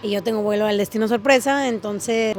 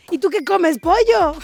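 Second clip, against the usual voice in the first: louder, rising mock-challenge question; teasing comeback; 5 semitones higher, 4 dB louder, slower than usual. Retelling a joke to her new friend, teasing comeback. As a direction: toss back a cheeky retort, higher at the end.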